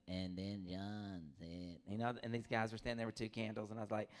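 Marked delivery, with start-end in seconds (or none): 0.1-1.8 mock priest, very slow and lower; droning priest. Pastor mocking a TV priest, very slow drone. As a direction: drag it out like a bored priest. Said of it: "He's like".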